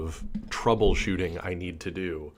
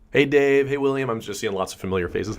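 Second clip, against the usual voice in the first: ordinary delivery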